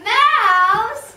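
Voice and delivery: loud rising mouse; alarmed question. Acted. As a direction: echo it in shock. Elephant echoes in alarm.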